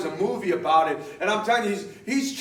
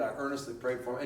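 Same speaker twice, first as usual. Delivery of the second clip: normal talking voice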